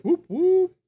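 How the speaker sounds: high, loud whooping cheer; excited whoop. Acted. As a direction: whoop it up for the segment. Segment intro cheer.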